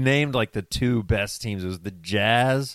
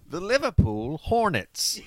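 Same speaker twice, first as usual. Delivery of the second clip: high, slow mock British accent; 9 semitones higher, slower than usual. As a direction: ham up a British announcer. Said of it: "fun to say in the British accent".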